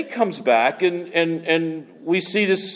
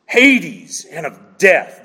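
"Hades and of death" very slow, every word drawn out; preacher dwelling on the words for the congregation; slow emphasis; 4 dB louder, much slower than usual. Real, not acted.

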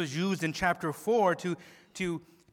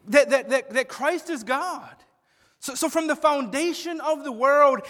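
higher, louder pulpit intensity; fervent preaching; 7 semitones higher, 7 dB louder than usual. Acted. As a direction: build urgency for the congregation. Preaching at full force.

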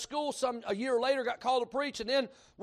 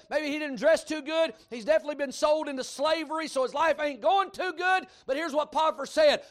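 normal talking voice

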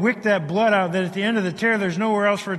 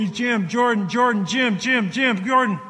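replayed calls, higher and a bit louder; urgent names called out in the retelling; calling out; 7 semitones higher, 4 dB louder than usual. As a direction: call for your friends across the slope. Calling for friends on mountain.

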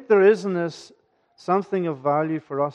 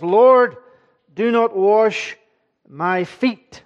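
raised, firm protest of a shocked disciple; categoric refusal; 4 semitones higher, 6 dB louder, much slower than usual. Retold or imitated. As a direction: refuse it outright, voice up and loud. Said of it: "Very categoric".

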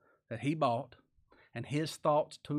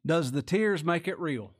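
slow, loud rhetorical question; preaching question; 3 semitones higher, 6 dB louder than usual. Real, not acted. Preacher to congregation, slow loud question. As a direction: drag it out to make them think.

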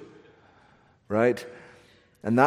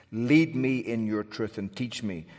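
ordinary delivery

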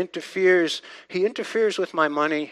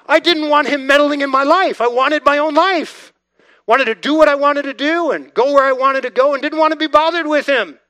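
defiant past self; 8 semitones higher, 9 dB louder than usual. Retold or imitated. Retelling his defiant atheist self.

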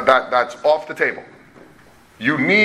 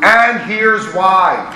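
"and here's why" stretched out slowly, leaning on "and"; slow, weighty "and here's why"; emphatic; 3 semitones higher, 4 dB louder, much slower than usual. Shown as a demonstration. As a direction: hit "and" hard, then draw out "here's why". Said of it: "that's my emphasis here".

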